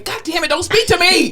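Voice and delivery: high, exasperated snap; mock irritated outburst. Worker in RBF answering a good morning, mock irritated. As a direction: snap as if greeted too early. Snapping back at morning greeting.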